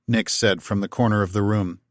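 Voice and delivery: usual speaking voice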